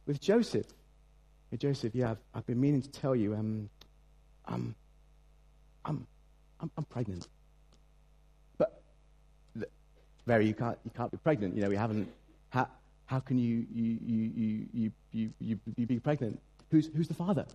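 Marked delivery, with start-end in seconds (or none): 1.5-7.3 soft, halting confession; a lightly voiced Mary, sheepish; hesitant Mary. Mary confesses pregnancy to Joseph. 8.6-17.5 stammering, lost for words; a lightly voiced Joseph, mock-baffled; flustered Joseph. Joseph reacts to Mary's news.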